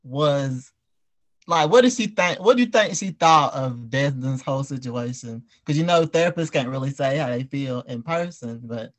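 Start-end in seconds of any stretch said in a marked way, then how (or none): none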